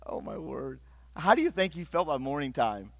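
usual speaking voice

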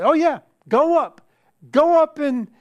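high, loud mock encouragement; sarcastic prophet. Prophet telling Ahab to go to battle, sarcastic. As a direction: egg him on with hammed-up false enthusiasm. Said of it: "that's kind of what the, the prophet's doing here".

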